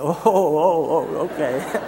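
drawn-out knowing ohs, amused; a string of ohs melting into a chuckle; dawning realization. Retold or imitated. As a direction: imitate someone suddenly catching on. Doctor reacts to learning he's a minister.

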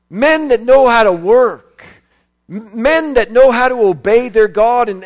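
loud fervent preaching; preaching at full force. Acted. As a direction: preach it hard to the congregation. Preaching about absent men.

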